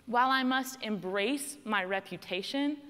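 ordinary talk

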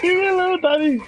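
put-on high, bratty kid voice calling Daddy; whiny child voice. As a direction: whine it like a spoiled kid.

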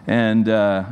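ordinary delivery

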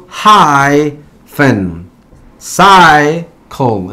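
hyphen, cycle split in two, first syllable long; slow HY... phen, CY... cle, Y as "eye"; stress on first syllable. Shown as a demonstration. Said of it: "la Y está situada en la sílaba tónica". (hyphen, cycle por sílabas, acento inicial alargado)

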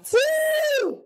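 very high, long 'woo'; celebrating whoop. Real, not acted. Streamer cheering his win, excited whoop. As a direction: let out a high victory whoop.

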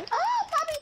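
sudden high yelp of alarm; panicked cry. Real, not acted. Gamer yelping at a friend, high and panicked.

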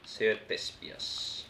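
slow whispered read; hushed slow whisper. Real, not acted. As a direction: breathe the words out slowly. Reads a game option.

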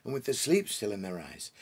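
ordinary delivery

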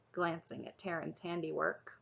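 ordinary delivery

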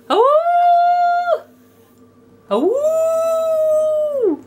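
high drawn-out wolf howl; mock howl. Owner howling along with the wolf, high mock howl.

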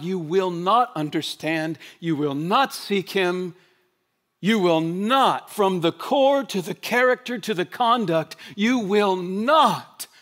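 each not stretched and weighted, slowed right down; slow emphatic not. Acted. Preacher drives home the warning to the congregation, slow and emphatic. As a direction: hammer the word not, slowly. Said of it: "preaching repetition".

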